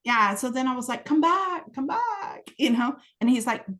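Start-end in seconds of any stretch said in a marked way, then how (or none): 1.0-2.5 high-pitched plaintive call; playful retold beckoning cry; mock calling after. Calling after vanished being.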